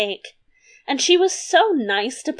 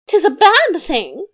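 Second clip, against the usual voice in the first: high-pitched queen voice; queen's answer; 7 semitones higher, 4 dB louder than usual. A narrator's reading. Queen starts to contradict the king, high protesting voice. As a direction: play a contrary queen about to be cut off. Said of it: "began the queen".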